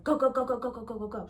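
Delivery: rapid-fire retold getaway urging; machine-gun the repeated word, bursting out then easing off; urgent urging. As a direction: imitate a panicky passenger hurrying the driver. Friend urging getaway, hitting dashboard.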